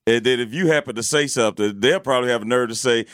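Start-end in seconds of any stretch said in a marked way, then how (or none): none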